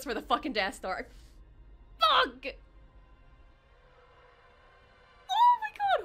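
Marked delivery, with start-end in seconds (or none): 2.0-6.0 much higher, louder outburst; stunned shout. Real, not acted. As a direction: yell at the reveal. Death Star reveal.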